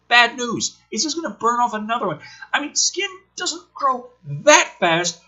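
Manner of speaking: high, exasperated ranting; incredulous rant. Real, not acted. Reviewer ranting at the skin burn scene, incredulous. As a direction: rant in disbelief.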